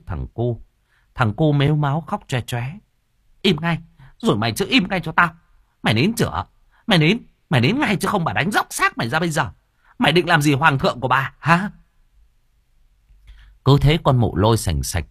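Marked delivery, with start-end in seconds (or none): 3.4-11.7 fast, high, rapid-fire yelling; furious threatening scold. Acted. Stepmother threatening the sobbing boy while beating him, fast high yelling. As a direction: bark threats at the crying child, fast and high.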